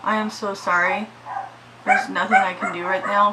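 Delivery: ordinary talk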